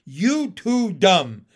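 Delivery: very slow, loud frog taunt; loud, drawn-out retort; shouting back. A narrator's reading. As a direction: hurl each word back separately. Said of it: "Pin shouted back".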